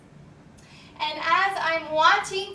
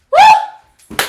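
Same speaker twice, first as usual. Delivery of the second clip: excited whoop; 17 dB louder than usual. Real, not acted. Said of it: "cheer after Namaste".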